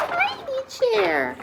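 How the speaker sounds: rising high squeal on the tiny thing; little, high, drawn-out voice; tiny-sized voice. A narrator's reading. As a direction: stretch it out, climbing way up. Narrator voices the chair's size.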